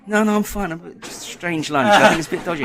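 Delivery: loud, high cover story yelled back; shouted excuse. Retold or imitated. Husband shouting a lie back from the loo, loud and high. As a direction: bluff loudly that all is fine.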